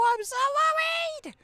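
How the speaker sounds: high, loud shrieking worry; worried squeal. Acted. Streamer voices worried Moogle, high shriek. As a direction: shriek like a frantic little critter. Voicing panicked game character.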